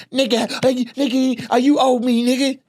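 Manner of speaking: high, whiny put-on voice of a demanding comic; high mocking imitation of a grabby comic. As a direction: mimic a pushy guy whining for money. Mimicking aggressive demanding comic.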